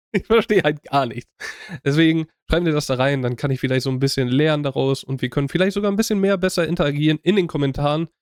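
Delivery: ordinary delivery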